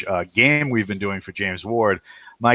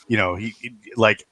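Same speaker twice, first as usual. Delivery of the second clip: normal talking voice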